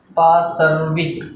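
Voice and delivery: drawn out syllable by syllable, पाशर्विक stretched long; slow spelled-out term. Shown as a demonstration. Teacher dictating the term to students, slow and drawn out. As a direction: say पाशर्विक very slowly so the class can copy. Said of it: "क्या बोलते हैं?".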